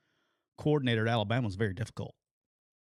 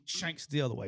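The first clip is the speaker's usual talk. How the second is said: ordinary talk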